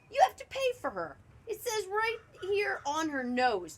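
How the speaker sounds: high, loud demand; insistent cashier. A narrator's reading. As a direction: insist on payment, pushing it up.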